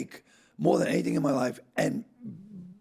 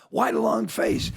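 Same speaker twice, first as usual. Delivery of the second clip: lightly acted bartender, higher and drawn out; joke bartender; 8 semitones higher, 4 dB louder than usual. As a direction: ask it as a joke setup, pitched up and slow. Bartender's punchline setup in a retold joke.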